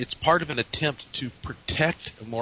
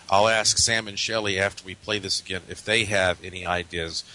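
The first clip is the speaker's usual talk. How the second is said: usual speaking voice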